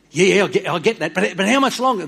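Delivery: high, loud impatient pushback; loud eager nagging; impatient prophet. Retold or imitated. Prophet pushing back at God.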